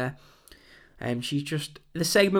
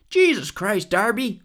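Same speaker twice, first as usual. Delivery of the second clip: higher, louder mock American exclamation; put-on American accent, pitched up and loud; bad American accent; 8 semitones higher, 5 dB louder, slower than usual. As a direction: badly imitate an American wrestler exclaiming in disbelief. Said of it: "that was a bad American accent".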